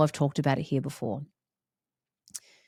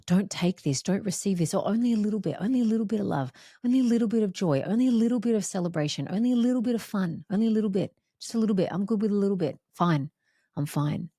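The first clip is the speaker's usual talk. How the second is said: higher, rushed, wheedling little-voice; imitating her limiting ego; 5 semitones higher, much faster than usual. Her old limiting ways talking her out of joy. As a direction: play the small scared ego bargaining down. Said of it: "contracted ways that said".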